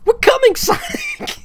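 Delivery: very high, loud squeal; squeaky cartoon voice. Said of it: "let me readjust".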